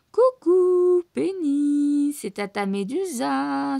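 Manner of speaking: high, drawn-out sugary greeting; sugary coo. A narrator's reading. Médusa coos at Penny with fake sweetness. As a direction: fake sweetness to lure her. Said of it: "susurre-t-elle".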